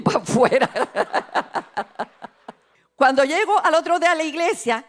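usual speaking voice

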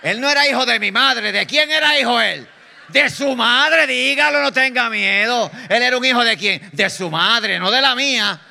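loud, high call to the congregation; preaching at full force. Real, not acted. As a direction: hammer it home, prodding the crowd to answer.

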